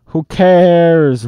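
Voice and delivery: loud, high pirate roar. A narrator's reading. Pirate captain roaring back at a critic. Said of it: "roared Cap'n Bones".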